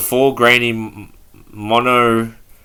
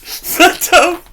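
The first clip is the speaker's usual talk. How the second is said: real high squeal through laughter; helpless laughter; 5 dB louder than usual. Real, not acted. Tells themselves to stop giggling at the name, helpless laughter.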